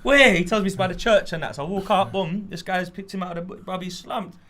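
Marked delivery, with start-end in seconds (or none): 0.0-0.3 high, loud startled what; mock shock on the phone. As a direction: jolt up in alarm. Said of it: "I'm like".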